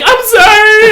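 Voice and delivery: high, loud frantic yelp; scared kid shrieking an apology at Rob; panicked apology. Retold or imitated. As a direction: blurt it out in terror. Kid drops scissors, apologizes.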